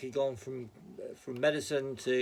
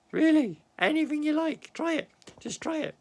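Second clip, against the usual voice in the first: high, loud teasing challenge; cheeky taunt to the viewers; mock dare; 13 semitones higher, 6 dB louder than usual. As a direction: goad them playfully to try it. Mock daring Americans to insult.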